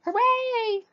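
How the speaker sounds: very high, loud hooray; Rabbit's high whoop of triumph; cheering hooray. Acted. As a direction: burst out in triumph. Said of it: "he cried".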